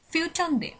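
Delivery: ফিউটন্দে said as Swedish "fyu-ton-de", rounded vowel, falling end; Swedish pronunciation demo. Shown as a demonstration. Teacher shows students how fourteenth changes, careful pronunciation. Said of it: "তখন কিন্তু change হয়ে যাচ্ছে".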